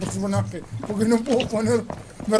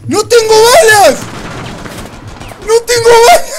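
loud, high panicked yell; panicking gamer; 11 semitones higher, 8 dB louder than usual. Real, not acted. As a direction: cry out in panic. Repeats, out of ammo.

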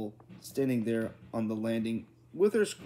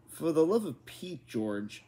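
exasperated, raised pitch dropping on the name; a restrained storybook shout, higher-pitched; mild narrated shout; 5 semitones higher than usual. A narrator's reading. Exasperated mother to her husband George, mildly shouted. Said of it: "shouted her mother".

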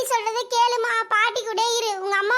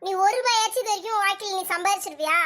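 usual speaking voice

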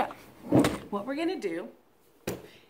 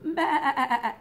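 sheep bleat; 2 semitones higher, 4 dB louder than usual. Shown as a demonstration. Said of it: "the sheep all go like this".

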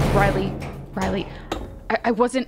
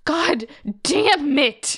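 higher, louder, slow furious cry; angry outburst; 7 semitones higher, 5 dB louder, slower than usual. Acted. Friend losing it at the speaker, furious. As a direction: let it burst out of you.

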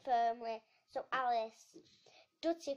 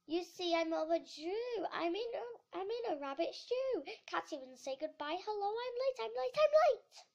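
high-pitched flustered rabbit, rising in panic; flustered rush; 7 semitones higher, 5 dB louder than usual. A narrator's reading. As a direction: fret loudly about being late, higher and higher.

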